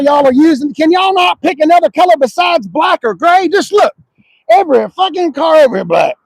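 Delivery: loud, high exasperated rant at carmakers. Real, not acted. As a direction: vent loudly like you're fed up. Ranting about dull car colours.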